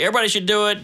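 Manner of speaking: ordinary talk, nothing marked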